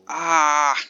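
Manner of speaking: loud, high drawn-out "ah"; frustrated yell. Real, not acted. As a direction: cry out in frustration. Frustrated after losing the dialogue.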